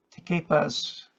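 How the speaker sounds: ordinary talk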